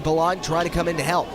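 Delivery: normal talking voice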